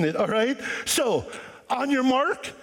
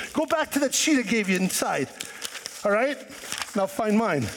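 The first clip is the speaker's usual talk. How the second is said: ordinary talk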